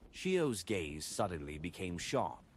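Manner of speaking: ordinary delivery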